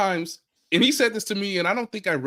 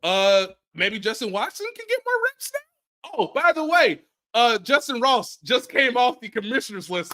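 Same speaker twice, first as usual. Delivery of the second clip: ordinary talk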